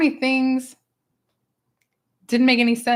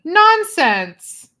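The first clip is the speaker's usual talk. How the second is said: high, loud, brushing it off; a bossy dismissive nonsense; loud high mock-insistent Nonsense; insistent character; 8 semitones higher, 8 dB louder than usual.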